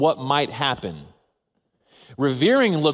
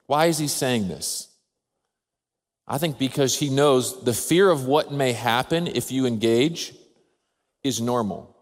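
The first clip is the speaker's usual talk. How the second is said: ordinary delivery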